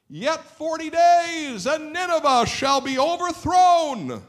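very high, drawn-out full shout; prophet calling out. Acted. Preacher as Jonah calling out doom to Nineveh, long high shout. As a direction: cry it slowly across a whole city. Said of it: "and he called out".

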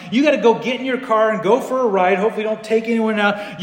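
ordinary talk, nothing marked

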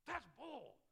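loud, high scoffing outburst; hammed-up indignant protest; mock outrage. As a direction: scoff at the radio like it's nonsense. Retold angry reaction to radio.

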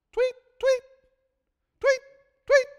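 high bird-like tweets; high tweet sound. Retold or imitated. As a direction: chirp like a Twitter alert. Imitating bird ringtone.

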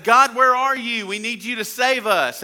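loud, high pleading to God; desperate cry. Retold or imitated. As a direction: call out like a drowning people. People crying out to God.

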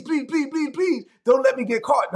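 pleading high and pinched, hamming up a guilty sinner; mock desperate prayer. Preacher mocking a sinner's panicked prayer, pleading. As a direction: beg God like someone about to be caught.

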